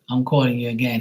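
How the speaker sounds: ordinary delivery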